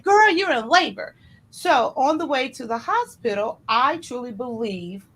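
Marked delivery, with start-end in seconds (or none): 0.0-1.1 higher and louder, a knowing mom; mom's announcement. Retold or imitated. Mom telling her daughter she's in labor, higher and louder. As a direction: tell her plainly it's time.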